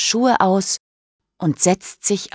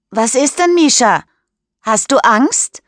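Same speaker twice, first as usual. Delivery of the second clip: higher, louder teasing call across the water; 6 semitones higher, 4 dB louder than usual. A narrator's reading. Daniel calls from the water to Mischa, loud teasing call. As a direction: shout it out to her from the lake, teasing. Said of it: "ruft Daniel".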